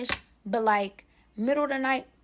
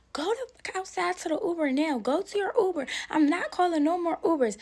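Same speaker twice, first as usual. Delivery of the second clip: high, sharp mother yelling; mom yelling; 7 semitones higher than usual. Retold or imitated. Mother ordering the boy out on the phone, mock yell. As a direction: shout at him to get outside.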